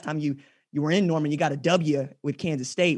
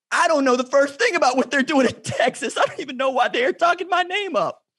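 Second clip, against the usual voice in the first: pitched way up, protesting he knows nothing; mock panicked denial; 9 semitones higher than usual. Interviewer mimicking the coach's frantic denial. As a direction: play the coach frantically denying the rumor. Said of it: "talk everybody but Coach Spurrier off a ledge".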